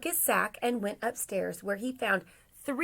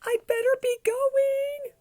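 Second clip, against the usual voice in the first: very high, loud exclamation; panicked Santa; 6 dB louder than usual. Acted. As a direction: sing it out high in a storybook fluster. Santa realizes he must leave.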